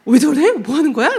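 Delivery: loud, startled outcry in the car; alarmed shout. Retold or imitated. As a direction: shout it out, half panicked. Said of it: "막, 막 난리였어요".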